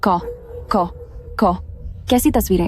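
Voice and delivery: halting stutter on the first syllable; stammering. Acted. Girl questions a threat about photos, stammering. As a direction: get stuck on the opening sound, then push the question out. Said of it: "छोटी हकलाने वाली".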